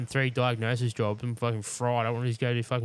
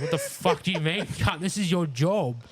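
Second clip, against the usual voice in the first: exasperated retold outburst; 6 semitones higher, slower than usual. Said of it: "I was like".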